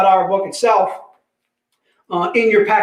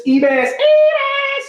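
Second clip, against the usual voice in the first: high drawn-out squeal; much slower than usual. Pretend. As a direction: stretch the name out very high. Hypes the book name, repeated.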